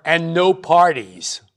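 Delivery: slow, drawn-out parting warning from a parent; emphatic parental command. Acted. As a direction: lay it down slowly as a house rule. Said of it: "one command ... that's emphasized".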